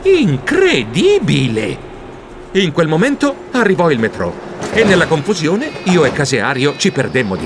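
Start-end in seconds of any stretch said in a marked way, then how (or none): 0.0-1.8 slow drawn-out astonishment; slow amazed exclamation; stunned. Acted. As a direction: stretch it out in disbelief. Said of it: "Io non sapevo cosa pensare".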